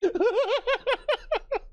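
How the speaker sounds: high laughing outburst; cracking up at the joke; high burst of laughter. Real, not acted. Reacts to the joke.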